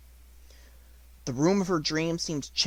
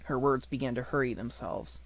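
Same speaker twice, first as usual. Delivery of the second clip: ordinary delivery, nothing marked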